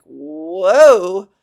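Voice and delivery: loud, swooping "whoa"; big drawn-out comic exclamation; mock whoa. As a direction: exclaim it like a wave sweeping back. Voices the swooping hair wave.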